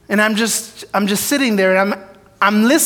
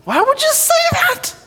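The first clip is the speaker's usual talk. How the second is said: much higher, mock-exasperated at himself; self-scolding squeak.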